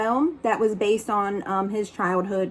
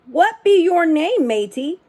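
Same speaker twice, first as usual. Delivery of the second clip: higher, gruff-toned pirate asking; pirate question; 6 semitones higher, 3 dB louder than usual. Acted. Head pirate asking the boy his name, higher hearty pirate voice. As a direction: ask like a hearty pirate captain. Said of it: "the head pirate asked".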